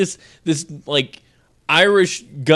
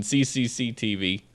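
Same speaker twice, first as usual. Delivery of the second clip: mock Russian spelling; 5 semitones lower, 8 dB quieter than usual. Shown as a demonstration. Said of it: "or if you're Russian".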